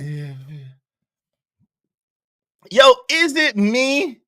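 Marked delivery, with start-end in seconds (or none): 0.0-0.6 very soft, low murmured agreement; mock indulgent agreement. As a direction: humor them under your breath. Acting out letting Joe keep talking.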